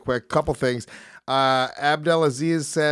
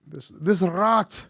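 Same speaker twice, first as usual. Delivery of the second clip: slow, higher, halting Irish attempt; attempted Irish accent; 5 semitones higher, much slower than usual. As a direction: feel your way into an Irish accent. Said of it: "Can you do an Irish accent?".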